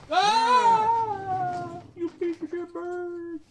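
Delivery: high, furious scream at a bird; angry yell. Acted. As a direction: shriek it out in rage. Player voices character attacking bird.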